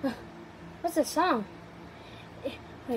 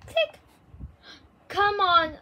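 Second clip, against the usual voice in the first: ordinary talk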